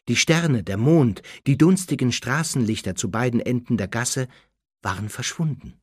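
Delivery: ordinary delivery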